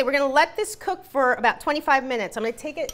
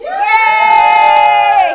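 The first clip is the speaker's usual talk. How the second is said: loud excited cheer; loud happy cheer for cocktails; 8 semitones higher, 14 dB louder than usual. Real, not acted. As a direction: celebrate it with a whoop.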